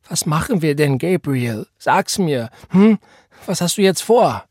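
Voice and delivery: put-on frightened girl demanding an answer; desperate pressing. A narrator's reading. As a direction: press him, nearly breaking. Esther presses Gabriel desperately.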